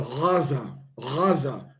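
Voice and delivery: "Gaza" with a soft throaty R-like start, "Raza"; "Gaza" begun with an open guttural G, never closing the throat; Arabic ghayin. Shown as a demonstration. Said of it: "the way the Arabs actually say Gaza".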